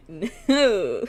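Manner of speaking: loud no through laughter; laughing no. Real, not acted. Streamer says this one was not consensual, laughing loudly. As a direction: blurt it out amid giggles.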